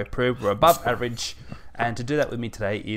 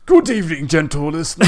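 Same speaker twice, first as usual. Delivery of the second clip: higher, louder laid-back dude voice; surfer dude; 4 semitones higher, 9 dB louder than usual. Acted. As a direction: chill surfer dude greeting. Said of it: "I just like doing the dude's voice".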